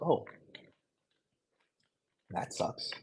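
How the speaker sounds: hushed, unbothered whisper; telling the cop it sucks, hushed and unbothered; whispered deadpan. Retold or imitated. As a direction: shrug it off under your breath. Deadpan reply to jail news.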